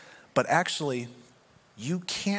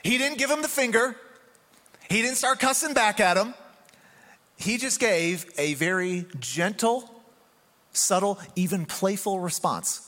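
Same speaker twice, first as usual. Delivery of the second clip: much higher, slow, punchy sermon voice; emphatic preaching; 9 semitones higher, slower than usual. Acted. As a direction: drive the point home to the congregation. Preaching climax.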